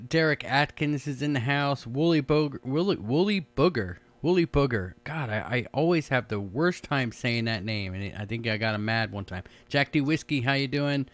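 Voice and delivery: ordinary talk, nothing marked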